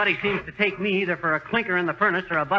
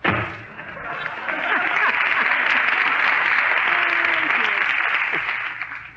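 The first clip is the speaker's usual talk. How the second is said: long wheezy laugh; 11 semitones higher, 5 dB louder than usual. Acted. Laugh after confusing customer.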